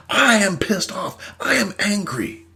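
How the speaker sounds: ordinary talk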